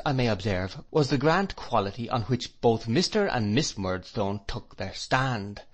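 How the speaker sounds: ordinary delivery, nothing marked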